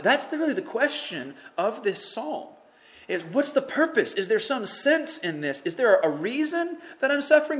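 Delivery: high, loud, urgent sermon voice; impassioned preaching. Acted. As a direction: preach it with fire. Preaching at full force.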